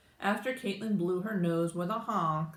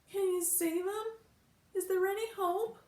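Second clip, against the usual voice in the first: tearful; 13 semitones higher than usual. A narrator's reading. Tearful client pleading.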